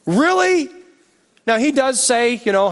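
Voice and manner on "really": stronger exasperation. Retold or imitated. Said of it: "he never just goes".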